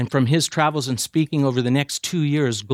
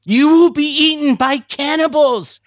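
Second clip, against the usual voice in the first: high, loud warning yell; retold yell; 12 semitones higher, 5 dB louder than usual. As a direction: cry out the danger to stop him. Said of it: "at the top of his voice yelled".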